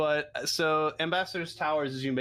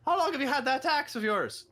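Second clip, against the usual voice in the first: gruff dwarf; 13 semitones higher than usual. Acted. GM voices dwarf NPC.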